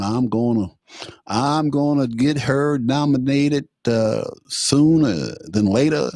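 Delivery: drawn-out Southern drawl, imitating Mitch; slow Southern gentleman. Commentator imitating Mitch promising the nomination, slow Southern drawl. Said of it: "he talks all slow".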